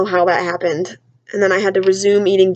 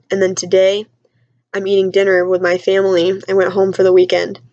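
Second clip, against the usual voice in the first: ordinary talk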